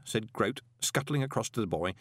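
plain reading voice

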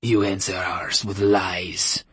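put-on slow Russian-accented hiss. A narrator's reading. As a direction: spit it out with contempt. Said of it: "the rabbi hissed".

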